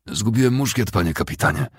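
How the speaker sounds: plain reading voice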